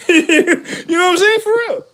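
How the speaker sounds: high, slow words broken by laughter; laughing. Real, not acted. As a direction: laugh through the question. Laughing hard at own story.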